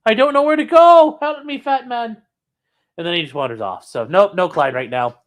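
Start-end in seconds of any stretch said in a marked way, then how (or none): 0.1-2.2 much higher and louder, a pleading dog; dog's whiny plea. Retold or imitated. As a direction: wail it like a helpless pup. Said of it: "He's like".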